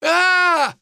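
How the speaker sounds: high, sustained wail; long falling-through-the-air cry, held high; acted drawn-out "aaah" scream; drawn-out yell. A narrator's reading. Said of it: "протяжно провопил очкарик".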